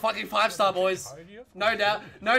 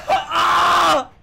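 high, loud yell; real full-voiced holler; excited scream; 4 semitones higher, 7 dB louder than usual. Real, not acted. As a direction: scream with joy. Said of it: "I'm yelling so much".

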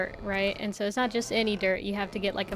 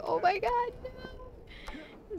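much higher, breathless horror; the shock of seeing him fall; horrified gasp; 4 dB quieter, much slower than usual. Retold or imitated. Retold panic quote.

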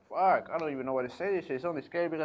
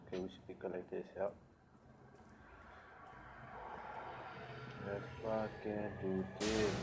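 quiet; 4 semitones lower, 10 dB quieter than usual. Real, not acted. Sneaking quietly through the dark.

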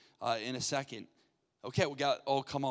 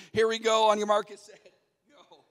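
loud, higher countdown call; raised-voice host launching the round; game-start call; 5 semitones higher, 8 dB louder than usual. Real, not acted.

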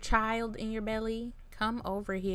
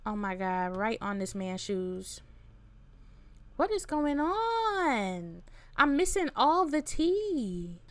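ordinary delivery

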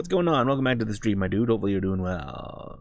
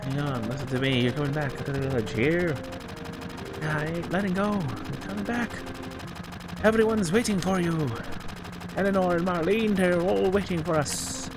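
dramatic Shakespearean actor; 6 semitones higher than usual. Acted. Voicing characters' dialogue in game.

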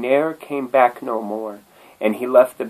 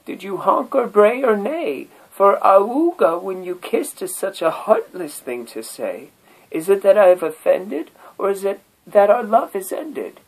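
high, louder, wounded turtle voice; hurt turtle; 12 semitones higher, 5 dB louder than usual. Acted. Turtle hurt by the bagpipe's honk, high and wounded. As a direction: cry out like a jilted turtle. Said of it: "Said the turtle to the bagpipe".

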